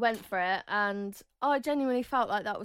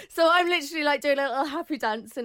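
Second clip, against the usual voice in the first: giddy excitement; 6 semitones higher, 8 dB louder than usual. Real, not acted. Giddy retelling.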